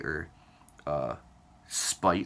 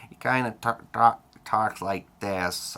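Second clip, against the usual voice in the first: slow, higher robotic impression; Ken Wilber; 4 semitones higher, 5 dB louder than usual. Showing how a very conscious author talks, mock robot voice. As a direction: talk like a robot, drawn out and pitched up. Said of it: "he almost sounds like a fucking robot".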